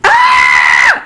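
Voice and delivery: very high mock shriek; short, high-pitched imitation scream; imitated woman's scream. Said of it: "and screams".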